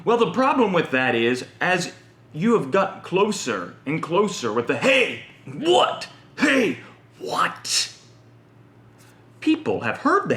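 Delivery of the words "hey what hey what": high, challenging "hey, what?"; taunting tough guy. Retold or imitated. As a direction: bait him into a fight. Confrontation taunt voiced.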